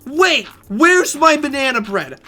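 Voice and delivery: high, loud mock yell from a car; imitated yelling. Customer yelling after the server from her car window, loud and frantic. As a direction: call out after him, frantic and demanding. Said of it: "I heard her yelling from her car".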